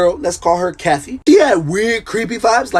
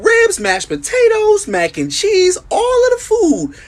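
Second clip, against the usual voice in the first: hyped food list; slower than usual. Acted. Fat kid listing grandma's cookout food, high and hyped. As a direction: savor every dish like a feast.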